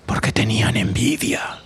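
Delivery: secretive whisper. Real, not acted. Storyteller whispering the secret to the children. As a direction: let them in on a secret. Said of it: "whispered aside to children".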